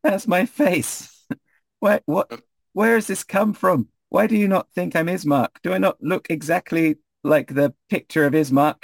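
high, wounded complaint; high-pitched, affronted character voice; indignant protest. Acted. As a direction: object to being poked and doubted. Poked Ismark protests being doubted.

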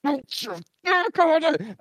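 very high, squealed imitation; mock sweet outburst. Host imitating the sweet community manager, high mock squeal. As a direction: put on a high, hammed-up character voice. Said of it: "all very sweet and innocent. It's just like".

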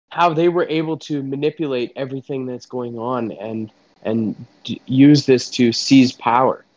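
ordinary talk

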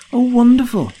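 very high, loud squeal of joy; delighted shriek. A narrator's reading. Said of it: "shrieked the apprentice".

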